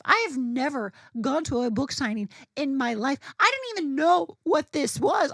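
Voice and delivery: high, loud, breathless-fan retelling; building from keyed-up to high-pitched disbelief; worked-up excitement. Retold or imitated. Fan recalling news of an author's signing, worked-up excitement.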